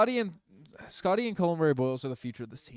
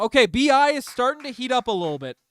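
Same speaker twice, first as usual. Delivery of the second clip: higher and louder, riding the excitement of the shot; excited; 8 semitones higher, 8 dB louder than usual. Real, not acted. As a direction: hype the player up. Excited reaction to a made shot.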